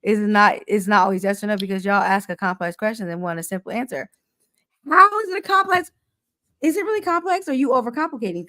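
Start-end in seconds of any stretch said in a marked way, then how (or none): 4.9-8.5 higher and louder, pushing back hard; exasperated raised voice. Real, not acted. Wife challenges her husband's excuse, loud and exasperated. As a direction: demand it, fed up.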